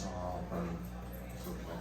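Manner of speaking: very soft, low comfort to the dog; soothing the dog. Real, not acted. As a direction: hush him gently.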